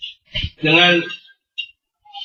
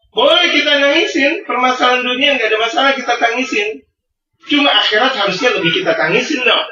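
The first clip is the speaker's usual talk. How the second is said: fiery preaching; 7 semitones higher than usual. Acted. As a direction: drive the point home to the congregation. Preaching at full force. (ceramah berapi-api, nada tinggi dan keras)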